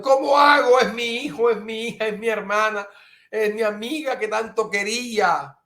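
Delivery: high pleading lament; anguished complaint. Retold or imitated. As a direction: plead for your loved ones. Quoting a distressed listener. (lamento agudo y suplicante)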